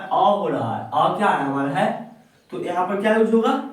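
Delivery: ordinary talk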